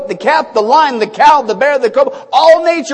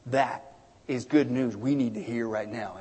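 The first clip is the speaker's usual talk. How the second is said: usual speaking voice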